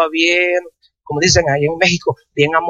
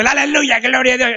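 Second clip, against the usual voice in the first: mocking showy praise; 6 semitones higher than usual. To the congregation, mimicking showy believers in a high mock praise. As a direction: poke fun at people who shout praise while driving. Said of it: "No es como unos que van hablando".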